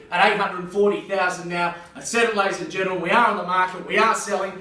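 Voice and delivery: loud closing call. Real, not acted. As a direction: drive the room to the final call. Auctioneer closing the sale.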